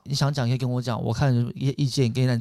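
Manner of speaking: soft reassuring. Retold or imitated. Coaxing a crying date to open up, soft and low.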